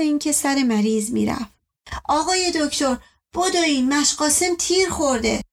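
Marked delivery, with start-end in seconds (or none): 2.0-5.4 higher-pitched breaking of bad news; urgent alarm. Child tells the doctor of a shooting, in urgent alarm. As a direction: plead for the doctor to hurry.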